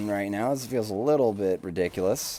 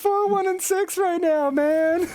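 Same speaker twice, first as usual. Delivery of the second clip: very high, strained exclamation; incredulous outcry; 4 dB louder than usual. Real, not acted. Caster reacting to Karma's score, high-pitched disbelief.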